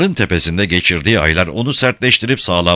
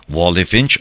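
ordinary delivery, nothing marked

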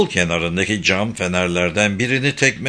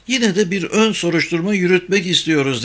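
higher-pitched, mildly insistent request; 7 semitones higher than usual. A narrator's reading. Saga asking for a preliminary investigation, insistently. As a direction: press the point in a raised pitch. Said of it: "dedi Saga ısrarla".

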